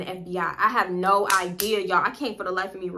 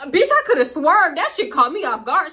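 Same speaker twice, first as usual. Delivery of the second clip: higher and louder, half shouting; real shocked shout; excited outburst; 7 semitones higher, 7 dB louder than usual. Real, not acted. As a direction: blurt it out caught off guard.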